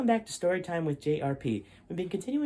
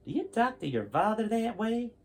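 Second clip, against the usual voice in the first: higher, indignant scolding voice; annoyed old man; 8 semitones higher than usual. Acted. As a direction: scold the rude kid. Larrabee annoyed at Jason.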